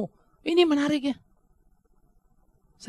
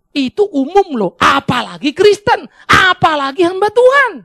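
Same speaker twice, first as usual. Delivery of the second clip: building emphasis; 9 semitones higher, 10 dB louder than usual. Real, not acted.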